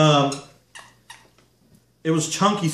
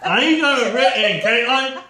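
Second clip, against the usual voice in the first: laughing; 8 semitones higher than usual. Real, not acted. Laughing protest during game.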